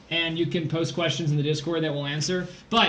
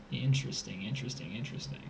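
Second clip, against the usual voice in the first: quiet; 6 semitones lower, 10 dB quieter, faster than usual. Real, not acted. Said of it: "musing over the problem".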